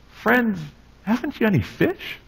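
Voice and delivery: Jesus asking his friends gently from the shore; gentle Jesus question. Acted. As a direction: ask it tenderly, almost under the breath.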